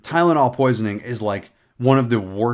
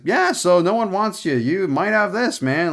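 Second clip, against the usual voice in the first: high-pitched, flippant caricature; hammed-up offhand delivery, pitched well up; exaggerated casual doctor; 8 semitones higher, 3 dB louder than usual. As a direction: imitate a doctor breaking bad news far too breezily. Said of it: "he didn't say it like that".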